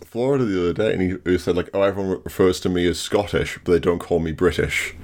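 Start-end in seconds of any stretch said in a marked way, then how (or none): none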